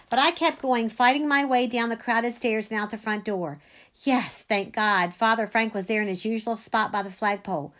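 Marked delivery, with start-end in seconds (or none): none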